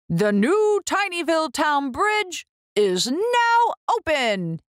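proud opening proclamation; mayor's announcement. Acted. Mayor opening the new bridge, proud. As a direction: declare the bridge open grandly. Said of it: "he says".

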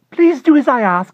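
very high and loud, urgent begging; frantic high plea. Acted. Klausner begging his neighbor to cut a rose, frantic shout. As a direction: plead frantically at the top of the voice. Klausner pleads over the fence.

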